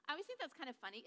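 ordinary talk

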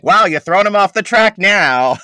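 high, loud comic jeer; mock evil muses. Evil muses heckling Kira's plan, shrill loud mockery. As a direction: crow it mockingly, way up high. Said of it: "they're just like".

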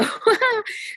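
high giggly laugh; embarrassed laugh. Real, not acted. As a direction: laugh it off, a bit flustered. Laughs after kid interrupts.

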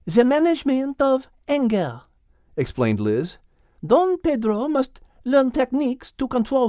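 put-on lightly French-accented lady's voice; a hint of French accent. Voices French characters Liz, Henriette.

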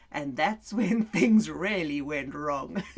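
usual speaking voice

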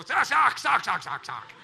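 loud chant of zak zak zak; a crowd laughing it out together. Pretend. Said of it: "all of us go".

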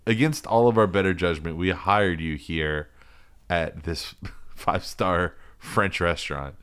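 a boss dressing down his waiter; hammed-up stern manager; mock restaurant boss. Restaurant boss scolding waiter Giuseppe.

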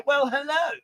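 high-pitched posh greeting; exaggerated posh "well, hello"; Leslie Phillips. Shown as a demonstration. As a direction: put on a posh accent, lifting the hello. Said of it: "Was that posh enough?".

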